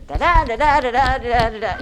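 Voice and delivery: loud, hammered da-DA beat; bad robotic scansion; mocking sing-song. Shown as a demonstration. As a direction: overdo the meter on purpose. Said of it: "not really a good look or sound".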